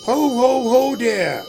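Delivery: jolly Santa laugh. Acted. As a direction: boom it out like a department-store Santa. George in Santa suit greeting.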